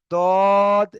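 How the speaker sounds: দদ drawn out, halfway between দ and জ; between dad and zad. Shown as a demonstration. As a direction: slide দদ between d and z. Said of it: "জদ এর মাঝখান থেকে উচ্চারণ হয়". (দ আর জ এর মাঝামাঝি টানা দদ)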